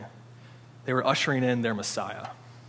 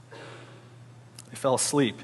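hushed; 5 dB quieter, much slower than usual. Acted. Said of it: "deadpan slow delivery".

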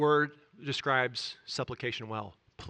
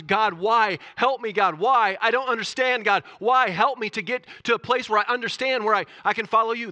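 desperate cry, higher and louder; anguished prayer; 8 semitones higher, 8 dB louder than usual. Retold or imitated. A hurting believer crying to God, anguished. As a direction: plead with God out of hurt. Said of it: "people are angry and they're hurting".

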